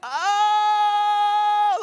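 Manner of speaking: long, high wailing yell; loud, high, drawn-out mock scream; imitated yell. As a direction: imitate a boy screaming in distress. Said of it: "he would just start yelling".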